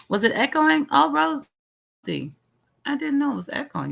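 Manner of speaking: high, startled realization; learning from chat that the stream audio was echoing, surprised. Real, not acted.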